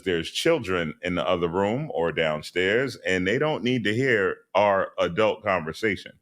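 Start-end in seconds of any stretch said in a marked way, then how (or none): none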